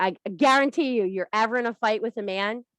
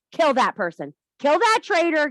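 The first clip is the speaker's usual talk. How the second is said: cult leader rant; 6 semitones higher, 5 dB louder than usual. Acted.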